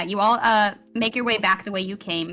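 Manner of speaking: usual speaking voice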